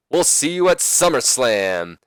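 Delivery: drawn-out acted promo sign-off; Ric Flair. Manager closes the challenge, slow hyped-up promo voice. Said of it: "Ric Flair says".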